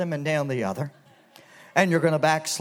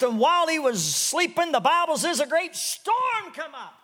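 full-force preaching; 11 semitones higher than usual. Acted. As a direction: preach it with rising fire. Preaching Bible story at full force.

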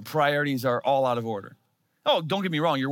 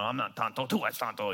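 sulky mutter under his breath, softer; mumbling under breath; 4 semitones lower, 6 dB quieter than usual. Retold or imitated. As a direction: grumble it quietly to yourself after being laughed at. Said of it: "I'm sitting there mumbling".

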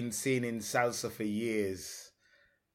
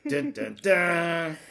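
slow, louder suspense music sting; dramatic sting; 6 semitones higher, 7 dB louder, much slower than usual. Pretend. Host building suspense before his question with a slow dun-dun-dun. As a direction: hum a slow game-show drum roll. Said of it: "dramatic question reveal".

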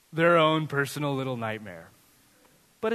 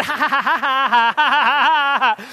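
imitated giggling; 8 semitones higher, 9 dB louder than usual. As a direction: giggle like a delighted little girl. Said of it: "And she's giggling".

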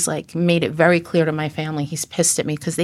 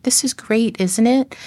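higher, forced upbeat voice; put-on cheerfulness; 5 semitones higher than usual. Daughter to her watching mother, forced cheerful. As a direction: retell a brave front, pitched up.

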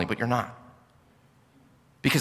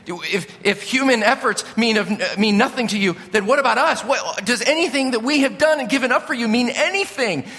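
Peter freaking out; 8 semitones higher than usual. Retold or imitated. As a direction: build Peter's plea toward a laughing squeal. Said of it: "and so he's freaking out".

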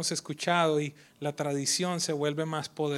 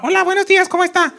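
much higher, loud, chirpy everyday hello; casual greeting voice; 15 dB louder, faster than usual. Retold or imitated. Pastor off the pulpit greeting people, high and casual. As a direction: switch to a bright offhand greeting. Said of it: "hablaba de una manera diferente".

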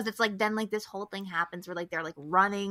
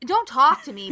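loud, higher mock order; mock bossy; 5 semitones higher, 11 dB louder than usual. To a tourist latching onto her cup, loud mock command. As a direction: bark it playfully.

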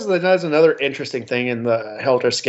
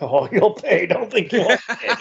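mock villain threat; 7 semitones higher than usual.

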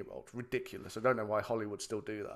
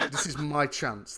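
ordinary delivery

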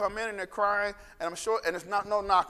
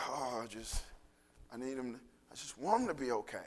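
sighing softly; 5 semitones lower, 11 dB quieter than usual. Retold or imitated. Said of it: "he takes this big sigh".

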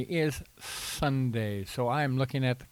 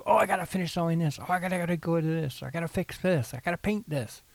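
higher, rushed mock panic; frantic last-minute cosplayer; 6 semitones higher, faster than usual. Cosplayer finishing a costume on the last day, mock frantic. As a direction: rattle it off like a hurried costume maker. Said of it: "they're knocking out the last of their costume".

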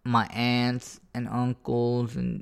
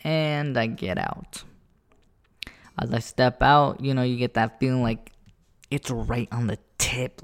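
ordinary delivery

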